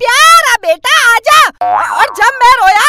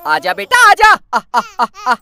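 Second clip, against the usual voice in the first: put-on coaxing baby; 5 semitones higher than usual. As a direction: call the little one in gently. Mother coaxing crying baby.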